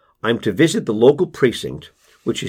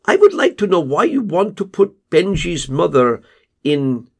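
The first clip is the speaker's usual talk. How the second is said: Casper hedging to the father, higher and level; Casper's voice; 6 semitones higher than usual. Acted. Narrator voices Casper on phone.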